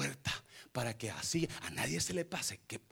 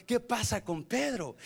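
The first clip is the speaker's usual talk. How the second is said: ordinary delivery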